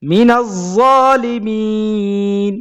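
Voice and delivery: slow, drawn-out chanted recitation; Quranic recitation. Shown as a demonstration. Teacher reciting a verse to students, slow and drawn out. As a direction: stretch each syllable as in tilawat.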